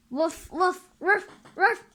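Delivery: high loud barks; dog barking. Acted. Dog barking at the stranger, loud barks. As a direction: bark like a dog.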